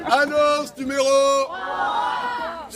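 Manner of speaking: slow ringing announcement; crier's announcement. Real, not acted.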